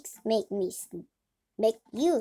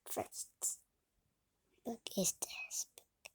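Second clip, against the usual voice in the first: whisper; 3 semitones lower, 11 dB quieter than usual. Real, not acted. Whispered intro to listener.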